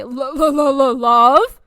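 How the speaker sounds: loud, hammed-up stutter of alarm; mock panicked stammer. Twenties guy overhearing the word love, mock panicked stammer.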